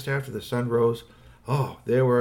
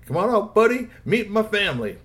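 higher, louder call to a buddy; hearty Clem; 10 semitones higher, 5 dB louder than usual. A narrator's reading. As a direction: call him out to meet the folks. Clem calls his buddy out.

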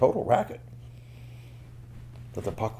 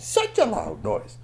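"such" pitched high and stretched, the phrase slowed; emphatic repeat; 10 semitones higher, slower than usual. Acted. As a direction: lean hard on "such", high and slow.

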